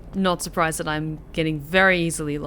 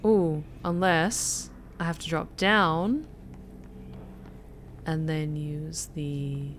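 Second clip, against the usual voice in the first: put-on softer, practiced American accent; 3 semitones lower, 8 dB quieter than usual. Gamer puzzling out a broken elevator, soft American accent. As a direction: think aloud in an American accent. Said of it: "talking like this".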